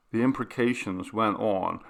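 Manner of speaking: ordinary delivery, nothing marked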